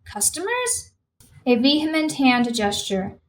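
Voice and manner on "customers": high, softer one-word question, rising sharply; incredulous query. A narrator's reading. Manager questioning the policeman about his customers, incredulous. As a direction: echo it back in disbelief, voice climbing.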